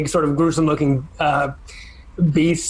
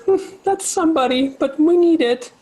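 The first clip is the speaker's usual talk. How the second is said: much higher, hesitant little creature voice; Lulu's voice; 14 semitones higher than usual. Acted. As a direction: say it reluctantly, small and unsure. DM voices sad Lulu.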